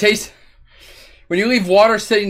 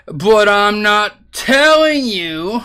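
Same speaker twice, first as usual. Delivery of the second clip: very slow, higher sing-song tease; playful teasing; 4 semitones higher, much slower than usual. Pretend. Streamer keeping a secret, teasing drawn-out refusal. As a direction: draw every word out, taunting.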